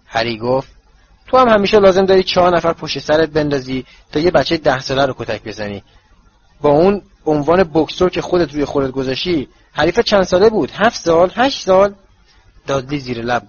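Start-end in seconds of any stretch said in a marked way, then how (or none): 1.3-12.0 taunting. Acted. Harry taunting Dudley about boxing.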